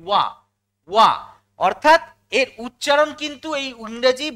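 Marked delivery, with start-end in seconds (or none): 0.1-1.1 ওয়া as one short "wa", said twice; ওয়া as a single W-sound syllable; joined pronunciation. Shown as a demonstration. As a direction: run ও into আ for ওয়া, then repeat it after a pause. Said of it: "উচ্চারণটা আমরা একসঙ্গে করি".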